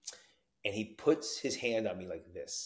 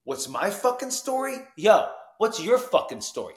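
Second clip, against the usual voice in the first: raised, louder, incredulous challenge; indignant disbelief; 10 semitones higher, 7 dB louder than usual. Retold or imitated. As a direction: throw the question back, higher and affronted. Mocks question in Jersey kid's voice.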